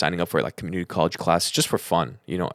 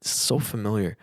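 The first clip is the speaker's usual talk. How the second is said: hushed puzzlement; 3 semitones higher, 3 dB quieter than usual. Retold or imitated. Acts out own puzzled thought.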